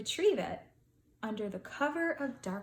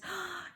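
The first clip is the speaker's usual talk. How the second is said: gasp; 8 semitones higher, 6 dB quieter than usual. Acted. Something terrible happened.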